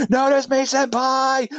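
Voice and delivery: high, slow, drawn-out begging; mock simping plea. Mock simping Batman, repeated pleading.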